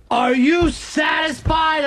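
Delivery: high, drawn-out scream at dad; retold scream. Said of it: "And I'm yelling".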